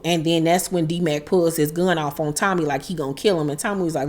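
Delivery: usual speaking voice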